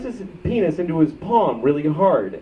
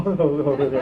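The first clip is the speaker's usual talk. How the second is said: imitated awkward chuckle through the words; laughing for no reason. Man getting elevator directions, laughing for no reason. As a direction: laugh pointlessly while answering "over there". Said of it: "What the fuck am I laughing at?".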